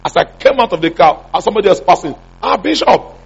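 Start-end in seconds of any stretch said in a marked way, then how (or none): 2.4-3.2 surprised call. Retold or imitated. Passerby calls out to the bishop.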